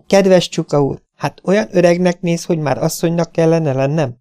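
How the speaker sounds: high-pitched, mirthful mock protest; light, high voice with a touch of laughter; laughing question. A narrator's reading. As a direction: ask it amused, pitched well up. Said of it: "kérdezte kacagva".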